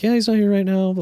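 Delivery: a hint of a polite brush-off; covering staffer. Staffer telling the visitor the boss is out, mock polite. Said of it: "they're like".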